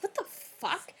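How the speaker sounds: high, hushed incredulous reaction; stunned disbelief. Acted. Podcaster imitating a viewer's reaction, hushed disbelief. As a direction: act out a baffled viewer under your breath.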